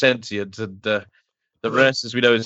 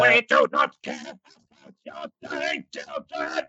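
mock Dalek voice; 12 semitones higher than usual.

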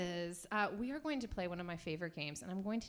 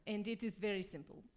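lightly put-on bad Russian accent; hammy Russian cooking host. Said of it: "speak in bad Russian accents".

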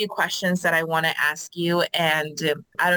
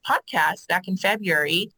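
ordinary talk, nothing marked

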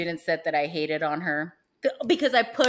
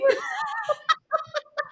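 breathless high laughing fit; high laughter; 4 dB louder than usual. Real, not acted. Woman still laughing at her mistake, high breathless laugh. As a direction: laugh until it almost breaks.

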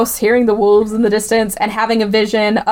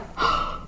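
soft shocked gasp; 10 dB quieter than usual. Retold or imitated. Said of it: "she's like".

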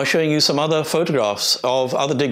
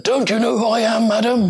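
put-on a grand old man put out at not being known; indignant old man; 10 semitones higher than usual. Old visitor rebuffs the supervisor.

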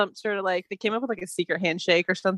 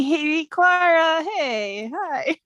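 high, drawn-out awkward hey; sheepish greeting; 6 semitones higher, slower than usual. Acted. Hava greets Clara after leaving her behind, high and sheepish. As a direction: stretch the hello, then apologise lightly.

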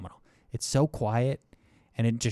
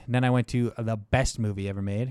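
ordinary delivery

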